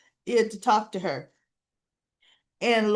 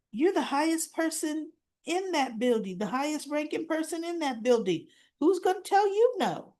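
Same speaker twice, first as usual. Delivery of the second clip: incredulous; 6 semitones higher than usual. Retold or imitated. Grandma argues, rising.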